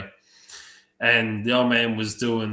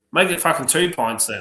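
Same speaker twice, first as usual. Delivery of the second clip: exasperated order; 5 semitones higher, 5 dB louder than usual. Retold or imitated.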